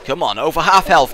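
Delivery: usual speaking voice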